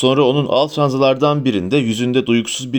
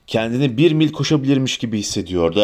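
ordinary delivery, nothing marked

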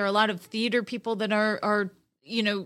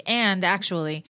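ordinary talk, nothing marked

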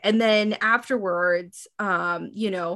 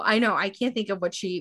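ordinary talk, nothing marked

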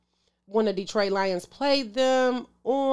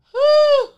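big high exhale of disbelief; loud exasperated whew; 11 dB louder than usual. Real, not acted. Fan reliving the loss, a loud pained whew.

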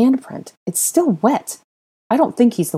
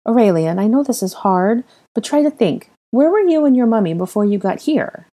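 higher, earnest coaxing question; serious; 6 semitones higher, 4 dB louder than usual. Acted. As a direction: urge the child to think. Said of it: "Harry asked seriously".

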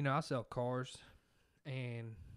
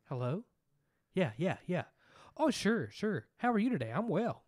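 high, loud polite phone manner; 6 semitones higher, 7 dB louder than usual. Shown as a demonstration. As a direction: put on the professional phone voice. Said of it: "like you're talking on the phone voice".

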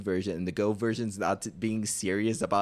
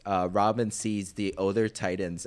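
ordinary talk, nothing marked

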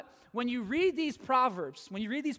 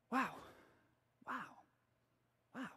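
hushed, musing admiration; quiet and reflective; gentle, thoughtful wow; 13 dB quieter, faster than usual. Shown as a demonstration. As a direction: say it softly, as if taking in an insight. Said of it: "nice and gentle and thoughtful and insightful".

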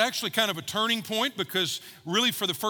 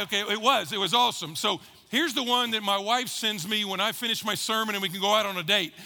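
normal talking voice

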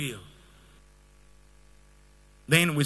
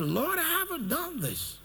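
protesting to the Lord; 10 semitones higher, 7 dB quieter than usual. Retold or imitated. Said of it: "And I was like".

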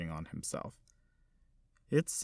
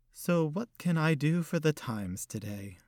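put-on elderly lady; 6 semitones higher than usual. Old hostess voiced by narrator.

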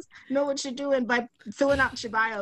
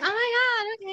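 much higher, squealing delight; excited squeal; 11 semitones higher, slower than usual. Retold or imitated. Teller voicing her excited younger self, squealing. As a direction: squeal like you can't believe it.

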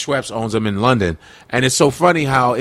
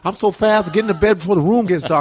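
higher-pitched boastful boxer impression; Muhammad Ali; 5 semitones higher than usual. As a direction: brag in a raised, imitated champ's voice. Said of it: "Ali used to say that".

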